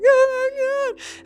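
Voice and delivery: very high, slow, breaking wail; tearful gasp. Retold or imitated. Finding the blue turtle, high slow tearful wail. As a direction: let it crack into tears. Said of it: "and I start crying".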